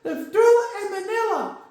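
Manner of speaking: high puppet crocodile voice, bragging; squeaky croc boasting about his punch; Corky boasting. Acted. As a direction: crow like a cartoon boxer croc. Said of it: "calm down, Corky".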